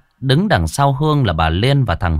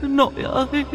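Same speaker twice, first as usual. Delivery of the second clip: very high, soft crying call; weeping; 9 dB quieter than usual. A narrator's reading. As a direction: sob out for grandma. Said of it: "Nó khóc lóc". (khóc gọi nội, giọng rất cao và nhỏ)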